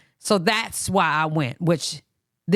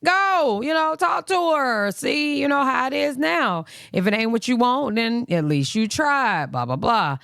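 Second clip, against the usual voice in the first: higher, motherly urging voice; mom's advice; 6 semitones higher than usual. Retold or imitated. Mom telling her son to go talk to her, higher urging voice. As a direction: urge him gently to go, like his mom. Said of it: "my mom, who raised me, is like".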